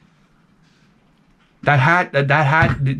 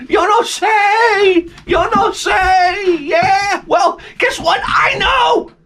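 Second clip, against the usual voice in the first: hammed-up tenant; much slower than usual. Mocking the downstairs tenant, high fake-crying shout. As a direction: ham up her fake crying act.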